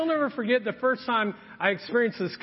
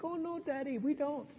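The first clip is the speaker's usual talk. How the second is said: put-on a higher, softer little-girl voice; mock little girl; 6 semitones higher, 10 dB quieter than usual. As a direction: play the innocent daughter. Daughters answering dad.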